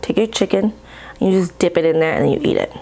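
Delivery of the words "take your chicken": ordinary talk, nothing marked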